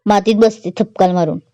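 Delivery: ordinary delivery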